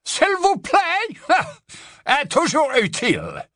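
high, slow sneering drawl; mock her politeness coldly. A narrator's reading. Portrait sneering at Hermione's politeness, cold mockery. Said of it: "une voix froide et narquoise".

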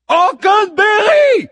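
high, booming, drawn-out yell; impatient goddess shout. A narrator's reading. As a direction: bellow the name impatiently. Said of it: "répète la muse impatiente". (cri impatient de la muse)

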